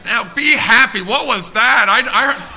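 loud, high exhortation, slow and driven; full-force preaching. Real, not acted. Announcing the baby, congregation cheers.

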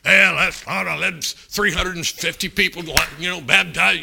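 hammed-up boastful tallying; mock bragging. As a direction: boom it out as if boasting of numbers. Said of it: "he didn't brag".

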